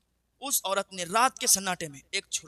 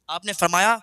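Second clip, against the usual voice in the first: ordinary talk, nothing marked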